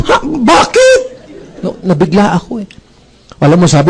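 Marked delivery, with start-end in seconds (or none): 0.1-1.0 higher startled laughing Ha; a boss startled at being scolded; surprised. Retold or imitated. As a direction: caught off guard, laughing. Startled reaction retold.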